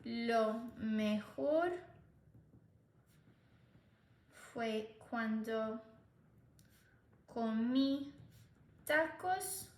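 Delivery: slow dictation. Shown as a demonstration. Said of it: "when I ate tacos".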